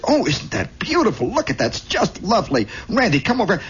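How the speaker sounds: high cooing mom voice; hammed-up motherly gushing. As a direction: coo over it like an admiring mother. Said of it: "And she looks at".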